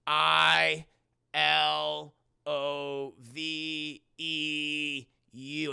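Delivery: very slow, letter by letter; long, deliberate stretched letters; spelled out slowly. Retold or imitated. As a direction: draw out each letter as if chalk is writing it. Spirit writing letters slowly in chalk.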